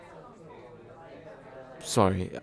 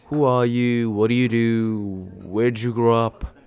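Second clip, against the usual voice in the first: mocking first-date questions; 4 dB louder than usual. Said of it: "we've done the whole".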